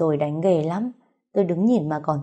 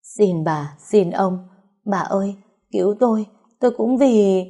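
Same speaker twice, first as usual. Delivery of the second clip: desperate begging; 3 semitones higher, 4 dB louder than usual. A narrator's reading. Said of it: "dập đầu van lạy".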